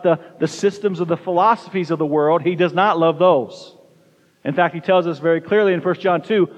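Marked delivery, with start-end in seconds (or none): none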